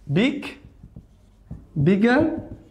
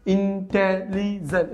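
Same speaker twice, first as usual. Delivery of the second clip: Intelligent slowly in four even beats; syllable by syllable; much slower than usual. Shown as a demonstration. Teacher repeating a four-syllable word, slowly syllabified. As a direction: mark each syllable of intelligent without long gaps. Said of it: "চারটা".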